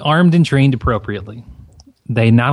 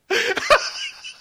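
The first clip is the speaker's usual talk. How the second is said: high-pitched laugh; 5 dB quieter than usual. Real, not acted. Laughing at the joke.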